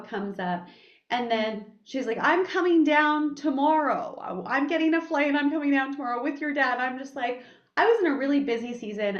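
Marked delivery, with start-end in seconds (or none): 2.2-6.8 raised, louder mom voice; insistent mother. Acted. Mom tells daughter she is flying down, insistent. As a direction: announce it like a mother who has decided. Mom announces an unwanted visit.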